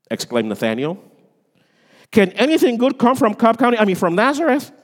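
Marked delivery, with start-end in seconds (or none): 2.1-4.7 high, loud, fast scoffing question; mock scornful exclaim. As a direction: scoff like a doubter, hammed up. Mock scornful quote, joke.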